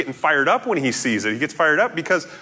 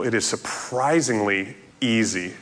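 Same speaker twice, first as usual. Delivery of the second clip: ordinary talk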